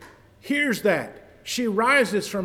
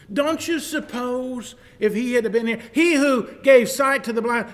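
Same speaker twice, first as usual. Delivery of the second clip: rising, louder scoffing challenge; mock mourners; 6 semitones higher, 5 dB louder than usual. Mourners doubting Jesus, hammed-up scoffing. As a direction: jeer at him.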